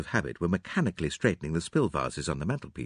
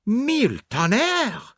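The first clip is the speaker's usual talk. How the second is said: dumbfounded cry, high and drawn out; Poirot; 5 dB louder, much slower than usual. A narrator's reading. Poirot finds the case open, dumbfounded cry. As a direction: exclaim slowly in high, loud shock. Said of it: "cried Poirot, dumbfounded".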